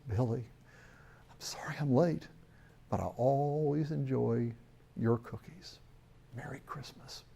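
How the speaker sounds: soft, hushed Santa fading to a whisper; tender and secret, like Santa in the dark; gentle Santa voice. Acted. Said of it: "the voice from the hand says".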